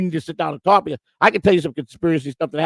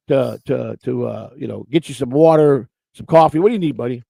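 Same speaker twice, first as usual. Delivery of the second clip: ordinary talk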